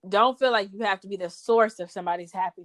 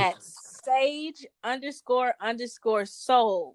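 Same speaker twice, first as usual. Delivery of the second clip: slow, deliberate handle read; spelling it out slowly; 4 semitones higher, much slower than usual. Real, not acted. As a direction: spell out each part slowly. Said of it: "Find me on Insta".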